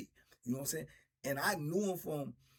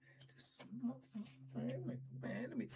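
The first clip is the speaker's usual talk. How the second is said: hushed panic; 7 semitones higher, 12 dB quieter than usual. Retold or imitated. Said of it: "Man, I be like".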